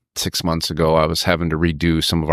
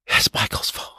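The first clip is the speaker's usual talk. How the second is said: hushed retelling; 4 dB quieter than usual. Retold or imitated. Office lady finding the phone.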